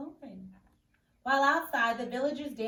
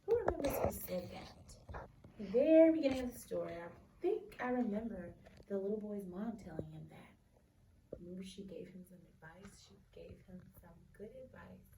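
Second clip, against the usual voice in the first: whisper; 2 semitones lower than usual. Real, not acted. Teacher whispers question.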